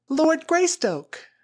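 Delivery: high, loud, slow cry of surprise; crying out in recognition. A narrator's reading. Flora recognizing Tarzan, high astonished cry. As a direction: shout his name in amazement. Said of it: "she cried".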